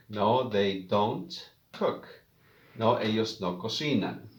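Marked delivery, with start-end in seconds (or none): 0.1-2.1 slow model answer. Shown as a demonstration.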